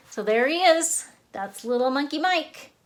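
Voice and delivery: ta-da, here he is; excited reveal. Real, not acted. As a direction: show off the drawing with delight. Presenting finished drawing to kids.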